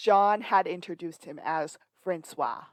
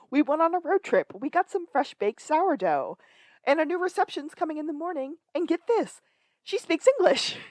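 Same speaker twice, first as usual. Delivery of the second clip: excited Sean quote; 10 semitones higher, 4 dB louder than usual. Retold or imitated. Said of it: "and he said".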